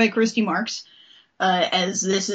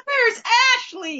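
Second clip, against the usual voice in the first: much higher mock wail; hammed-up panicked cry; 13 semitones higher than usual. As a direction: imitate a distraught cartoon heroine, laughing. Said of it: "in normal speaking tone".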